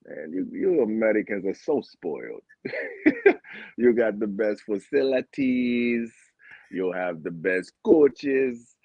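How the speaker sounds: imitating a Jamaican friend's accent; lightly put-on Jamaican voice, scolding; a hint of Jamaican patois. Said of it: "that's my Jamaican accent".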